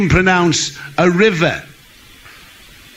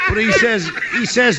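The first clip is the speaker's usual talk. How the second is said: normal talking voice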